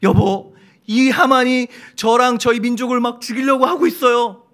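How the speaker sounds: a wife pleading loudly for her people; earnest plea. Pretend. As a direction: beg the king urgently. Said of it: "간절한 목소리로".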